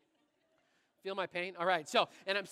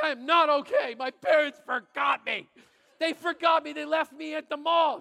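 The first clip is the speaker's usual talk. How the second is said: put-on upset kid yell; 4 semitones higher, 6 dB louder than usual. Lost boy yelling to a kind stranger, loud upset wail. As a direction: throw the hurt at her full force. Kid tells lady he's forgotten.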